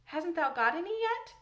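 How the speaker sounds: high, rising puzzled question; lifted voice of mild bafflement. A narrator's reading. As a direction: press for an answer, baffled. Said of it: "he began to be puzzled".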